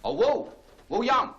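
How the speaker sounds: loud call across the house. Acted. Cranston calling his servant from afar. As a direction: call out for Wu from another room.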